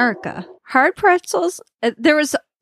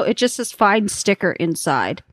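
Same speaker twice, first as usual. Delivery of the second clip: usual speaking voice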